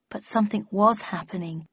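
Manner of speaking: ordinary delivery, nothing marked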